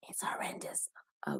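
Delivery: hushed, breathy whisper about her bad accent; whispered guten Abend, unvoiced and soft. Real, not acted. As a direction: whisper it like a confession.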